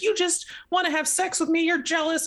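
high-pitched indignant retort; mock accusation. Acted retort in book recap.

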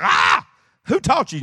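high, loud amazed shout; hammed-up astonishment at his playing; amazed teacher shouting her question.

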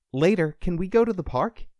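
put-on high child voice asking hopefully; little boy. A narrator's reading. Boy asks dad for outing.

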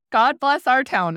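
weary irony repeating the blessing; sarcastic blessing. Retold or imitated. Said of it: "And I was like".

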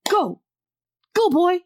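high, loud mock shooing of a stray. Davy shooing Jack off like a stray, high mock voice. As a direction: shoo him away like an unwanted dog.

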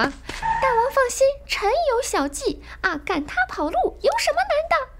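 a meddling little fish minister offering his trick; fish advisor. Acted. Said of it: "帮倒忙的这个人啊 … 他是个鱼啊".

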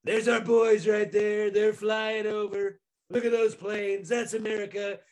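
slow, drawn-out mock Trump boast. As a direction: drag out a proud flyover boast like Trump. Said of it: "and he goes".

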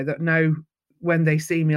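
ordinary talk

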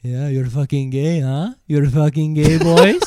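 retold taunt building to a loud, rising jeer; mock taunting. Retold drunken taunts, getting louder.

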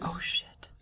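hushed oh shit; whispered curse. Real, not acted. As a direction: whisper it in dismay. Realizes she forgot headdress.

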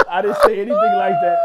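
high, loud laughing protest; laughing shout. Real, not acted. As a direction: yell the denial through laughter. Denying the accusation, louder.

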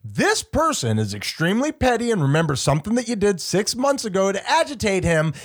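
ordinary talk, nothing marked

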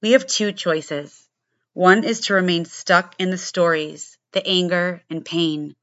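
punchy, low-pitched show opener; scripted podcast intro. Acted. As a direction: deliver a prepared opening monologue with firm, deliberate emphasis.

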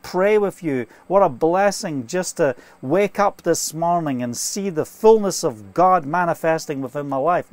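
ordinary talk, nothing marked

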